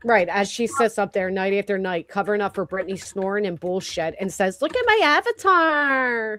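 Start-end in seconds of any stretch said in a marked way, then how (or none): 4.6-6.4 high, slow, whining imitation; whiny voice. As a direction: whine it slowly and smugly. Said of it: "Always whining".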